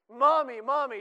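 put-on high, loud desperate child's cry; a preacher imitating a sobbing child calling her mother; child still wailing. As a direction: wail it like an inconsolable little girl. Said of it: "she just keeps going".

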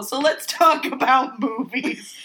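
loud, high laughing. Real, not acted. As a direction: laugh while changing subject. Laughing change of subject.

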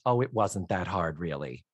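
modest quote. Retold or imitated. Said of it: "Cloris Leachman says".

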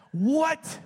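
loud, high-pitched "what?!"; re-enacted stunned shout; shocked outburst. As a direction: blurt it in total disbelief. Re-enacted shock at roommate news.

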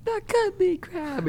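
very high, drawn-out cartoon voice; cartoon character voice. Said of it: "and he's like".

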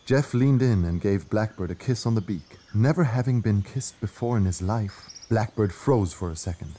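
usual speaking voice

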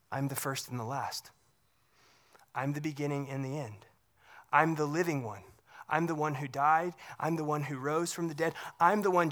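hushed tender reassurance; soft quoting. Acted. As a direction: speak it quietly, like a comforting promise. Voicing Jesus' words gently.

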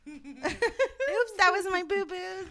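put-on high childish squeal; little girl voice. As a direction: play a giggly little kid. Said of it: "act like children women".

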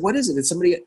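mild retold panic; panicked question. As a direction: rush in afraid something happened to the kids. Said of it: "I said".

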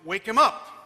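loud barked command as the President; demanding order. Retold or imitated. President on the phone ordering the housekeeper to wake him, loud command. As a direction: order it, no arguing.

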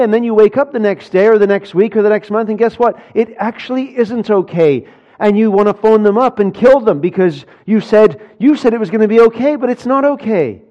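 loud, fast, rising sermon climax; preaching at full force. Acted. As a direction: hammer the point home to the congregation.

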